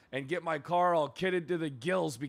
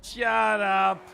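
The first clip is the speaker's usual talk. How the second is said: louder, higher mock outrage; shouted shut up; 6 semitones higher, 8 dB louder than usual. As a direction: yell it, playfully outraged. Second, bigger retort.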